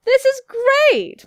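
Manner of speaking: high, loud, drawn-out delight; gushing acted enthusiasm; delighted exclamation. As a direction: exclaim it with big joy. Character delighted to meet human.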